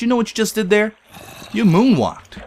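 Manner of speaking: loud, high excited yell; excited shout. Retold or imitated. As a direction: shout it with amazement. Excited calling out to Kurt.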